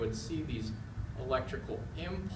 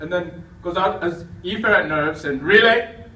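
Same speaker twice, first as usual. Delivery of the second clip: excited lecture; 3 semitones higher, 10 dB louder than usual. Acted. Lecturer drilling class on nerves.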